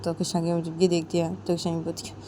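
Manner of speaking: usual speaking voice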